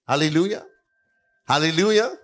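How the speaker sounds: normal talking voice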